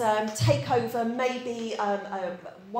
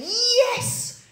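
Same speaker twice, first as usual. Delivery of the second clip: high, bursting with hammed-up cheer; mock joyful; 5 semitones higher, slower than usual. Preacher acting out joyful waking, hammed-up elation. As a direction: leap out of bed in mock rapture. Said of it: "you're all laughing at me".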